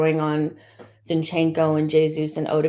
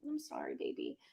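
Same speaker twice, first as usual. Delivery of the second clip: much softer and lower, murmured to the pet; soft apology; 18 dB quieter than usual. Real, not acted. Fan apologising to the pet for shouting, quiet. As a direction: say sorry gently to the little one beside you.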